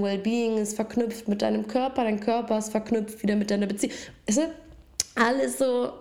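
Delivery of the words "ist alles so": words pushed through a choke; choking. Real, not acted. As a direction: voice caught mid-choke. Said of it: "Ich hab mich verschluckt".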